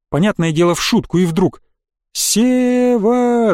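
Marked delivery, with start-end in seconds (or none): 2.2-3.5 high, long-drawn name call; distant call. A narrator's reading. Trapped boy calling his friend, drawn-out cry. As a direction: call up from under the ground. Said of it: "донеслось до меня откуда-то снизу".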